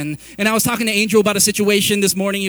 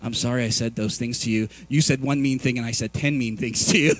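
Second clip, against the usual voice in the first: apology; 10 semitones lower than usual. Retold or imitated. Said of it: "And I'm like".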